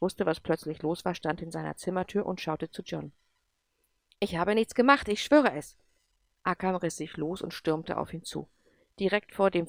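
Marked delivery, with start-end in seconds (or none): 4.2-5.8 louder, pleading; a startled boy swearing innocence; scared denial. Acted. Frightened Andrew denies guilt. (laut und verängstigt beteuernd)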